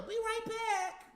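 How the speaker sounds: high, slow mocking voice of her leaving; mock Natalie.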